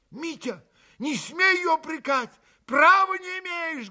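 high, loud hot rebuke; heated shout. A narrator's reading. Alyosha shouts at his brother Mitya, heated. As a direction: shout at your brother hotly. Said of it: "горячо крикнул на брата Алёша".

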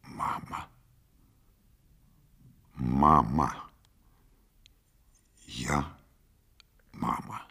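hushed, absent murmur with long pauses; addressed to no one. A narrator's reading. Mother talking into space, absent and hushed. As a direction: let each word drop softly into emptiness. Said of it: "ни к кому не обращаясь, в пространство".

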